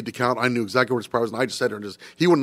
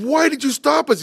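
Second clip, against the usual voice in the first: indignant conductor; 14 semitones higher, 3 dB louder than usual. Retold or imitated. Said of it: "he said".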